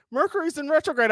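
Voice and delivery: a high mock-earnest woman's voice. Man mimics a woman warning him about astrology, high and mocking. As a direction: parrot her warning in a high singsong tone. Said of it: "Anytime a woman's like".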